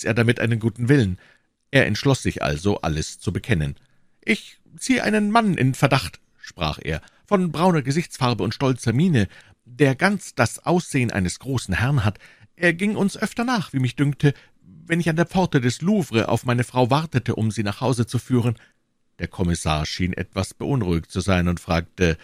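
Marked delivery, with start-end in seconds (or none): 4.2-6.2 high, plaintive shopkeeper voice; anxious prisoner. Acted. Voices Bonacieux confessing. 7.3-18.6 high, earnest shopkeeper voice; eager anxious prisoner. Acted. Voices Bonacieux confessing.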